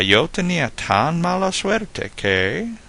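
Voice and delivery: high, slow build with "tan" stretched out; exaggerated slow setup, "que" left hanging; joke-opener drawl. Shown as a demonstration. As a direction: hold "tan" and "que" long, teasing the punchline. Acted opening of a Spanish joke.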